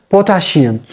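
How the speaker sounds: "potassium" with sh for the double s; "potassium" said like "potashium"; mispronounced potassium. Shown as a demonstration. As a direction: say "potassium" as po-ta-shee-um. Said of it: "it is not potassium".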